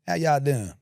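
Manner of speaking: put-on understated Southern accent, easy and casual; lightly imitated Southern accent; natural-sounding accent impression. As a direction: toss off a Southern greeting without overdoing it. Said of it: "just does it natural".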